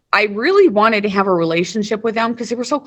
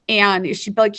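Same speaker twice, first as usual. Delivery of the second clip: ordinary delivery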